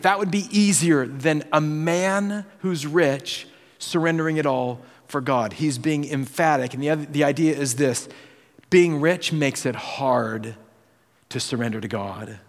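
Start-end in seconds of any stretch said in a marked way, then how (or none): none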